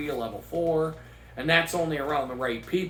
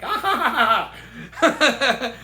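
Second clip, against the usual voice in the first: loud, higher mock ha-ha; fake laugh; 7 semitones higher, 7 dB louder, slower than usual. Girls' reaction acted out as a loud fake laugh. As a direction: spell out a staged laugh. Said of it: "it was like".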